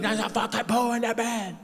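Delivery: high-pitched, hammed-up freak-out; mock outrage. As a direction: act out a horrified panic, voice jumping high. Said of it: "I used to be like".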